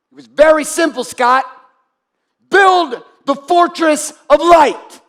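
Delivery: rising shouted sermon climax; preaching at full force. Acted. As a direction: hammer "We are the answer" home like a revival preacher. Chanting rallying cry to audience.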